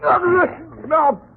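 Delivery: high, panicked plea under blows; pained cry. Acted. Krug crying out in pain while Butch hits him. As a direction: cry out as the thug hits you.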